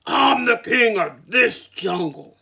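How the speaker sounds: loud, slow, proud boast; with attitude. Acted. Lion boasts to the rabbit with loud attitude. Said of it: "with an attitude".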